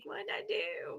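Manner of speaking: hushed, voiceless "who, me?"; retold in a small playful whisper; innocent whisper. Retells her innocent reaction in court.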